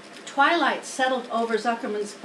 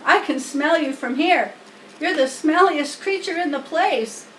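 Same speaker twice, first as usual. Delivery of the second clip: a lightly voiced lamb, louder and taunting; mocking lamb; 5 semitones higher, 5 dB louder than usual. A narrator's reading. Lamb walking in teases the pig, loud taunt. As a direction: sneer at the pig.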